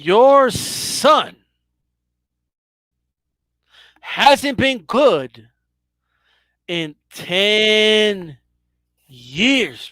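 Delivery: slow, with long pauses after "son" and "good"; deliberate needling, "hasn't been good" spaced out; drawn-out taunt. Pretend. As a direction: stretch "ten" and "years" for effect. Host repeats the drawn-out taunt.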